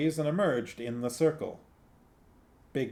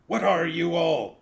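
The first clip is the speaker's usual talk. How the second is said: higher, louder angry snap; snapped; 7 semitones higher, 6 dB louder than usual. Acted. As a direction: bite off the question in anger. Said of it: "snapped Big Fido".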